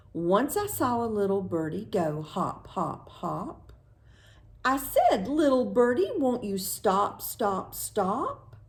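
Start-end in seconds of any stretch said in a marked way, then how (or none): none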